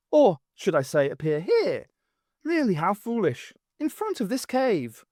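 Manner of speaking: lightly voiced grand lady, mocking; haughty lady voice. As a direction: lift the pitch, sneer a little. Maisie Grace mocking.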